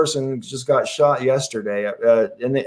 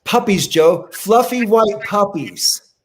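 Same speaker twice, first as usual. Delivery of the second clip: coaxing sing-song, higher and drawn out at the end; playful cheering up; 6 semitones higher, slower than usual. Pretend. Panelist cheering up an upset friend with puppies, playfully coaxing. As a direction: dangle the words like a treat, stretching the last phrase.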